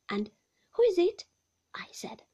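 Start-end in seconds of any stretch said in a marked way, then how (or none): 0.7-1.3 high, startled and loud; a frightened cry into the phone. A narrator's reading. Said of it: "took the receiver off with trembling hands".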